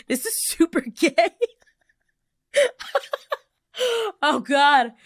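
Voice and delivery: high voice breaking into laughing tears; emotional, tearful laughing. Real, not acted. Host embarrassed by crying on own show, tearful laugh. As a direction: laugh while starting to cry. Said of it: "I'm getting so emotional right now".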